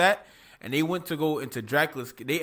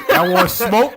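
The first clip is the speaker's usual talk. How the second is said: tough Dracula shout; 7 semitones higher, 10 dB louder, slower than usual. Retold or imitated. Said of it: "came out the clouds like".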